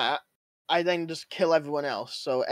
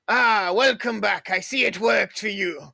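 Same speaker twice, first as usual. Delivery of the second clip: put-on slow, high old pirate voice; pirate shopkeeper; 4 semitones higher, 4 dB louder, slower than usual. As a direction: drawl like a seafaring shopkeeper. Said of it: "What does he sound like? Is, is he a pirate?".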